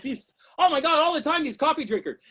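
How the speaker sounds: normal talking voice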